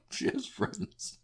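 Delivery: high, squeaky, slow through held-back laughter; stifled laugh. Retold or imitated. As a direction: try to say it straight while fighting giggles. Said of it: "I stifle my laugh".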